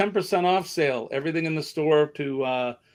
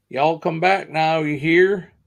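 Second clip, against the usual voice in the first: light Southern drawl, a bit louder; Southern accent; 2 semitones higher, 6 dB louder than usual. Shown as a demonstration. Host showing viewers how Southerners speak, in a light Southern accent. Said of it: "That's the Southern".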